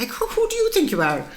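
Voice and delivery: high, scornful disbelief; mocking incredulous. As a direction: scoff at her like a know-all colleague. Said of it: "the rest of the women said".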